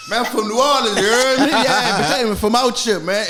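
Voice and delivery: put-on New Orleans accent. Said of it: "put on the biggest New Orleans accent".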